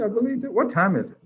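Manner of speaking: ordinary talk, nothing marked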